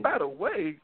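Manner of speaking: high, disbelieving question; incredulous. Real, not acted. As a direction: echo it in disbelief. Incredulous echo of the quote.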